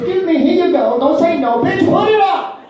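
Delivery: high-pitched insistent clerk, climbing; hammed-up exasperated salesman; pushy shoe salesman. Shoe store man handing over little sock, high insistent order. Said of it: "he goes".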